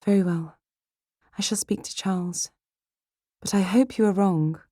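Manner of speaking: high-pitched, gently conceding; troubled young lady, voice lifted; reluctant sister Jane. Acted. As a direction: give in, still hoping for the best.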